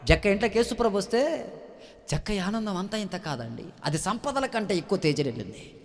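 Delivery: ordinary talk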